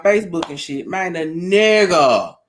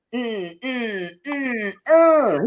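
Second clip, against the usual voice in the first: imitated digging grunts; 3 semitones higher, 3 dB quieter, much slower than usual. Woman mimicking her ex digging through her phone, grunting.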